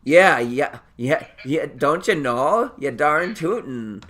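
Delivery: loud, folksy Minnesotan accent. Shown as a demonstration. Showing off the accent picked up there, playful Minnesotan accent. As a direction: put on a hammed-up Minnesota accent. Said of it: "kind of gotten a Minnesotan accent".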